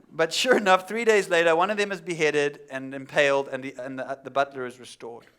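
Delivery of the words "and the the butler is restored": ordinary talk, nothing marked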